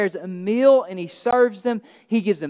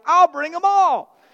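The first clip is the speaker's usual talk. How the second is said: higher, louder, slow and gung-ho; hammed-up eager Peter volunteering everything; 7 semitones higher, 4 dB louder, slower than usual. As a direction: overeager boast, all in. Eager Peter answering Jesus.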